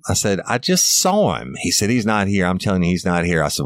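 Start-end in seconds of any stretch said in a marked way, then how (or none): 0.5-1.5 insistent shout. Retold or imitated. Officer insisting to lying father. 1.9-3.4 father insisting. Retold or imitated. Father insisting, voiced high.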